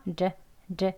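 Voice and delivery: 르 said as a soft flapped r. Shown as a demonstration. Teacher shows the flap T as a soft r. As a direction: tap 르 lightly, no hard T. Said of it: "부드러운 리을 소리 나게".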